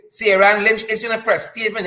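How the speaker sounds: ordinary talk, nothing marked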